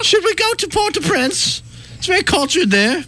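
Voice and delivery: high, put-on fancy voice; mock-refined high voice; fancy vacation planner. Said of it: "I get very fancy".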